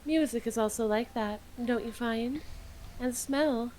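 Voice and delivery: dreamy hushed recital; soft ASMR voice. Pretend. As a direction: murmur it like an ASMR script. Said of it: "doing A S M R voice".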